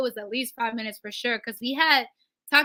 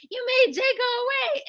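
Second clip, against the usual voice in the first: mock accusation, pitched much higher; friend's accusing line; 12 semitones higher than usual. Friend scolding her after [name] leaves, high mock complaint. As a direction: throw the blame in a high, put-on voice. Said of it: "she was like".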